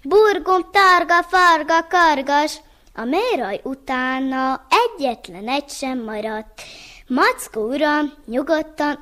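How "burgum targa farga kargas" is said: Burgum, targa, farga, kargas yelled higher and louder, fading by the end; yelled spell. Acted. Stung bear bellowing the spell as he flees, yelling. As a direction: shout the magic words while running off. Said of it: "egyre azt üvöltötte futás közben".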